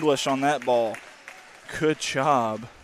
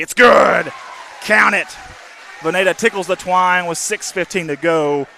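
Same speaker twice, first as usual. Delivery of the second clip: loud, high excited call; excited shout; 5 semitones higher, 10 dB louder than usual. Real, not acted. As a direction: yell the made basket. Basket made, play-by-play call.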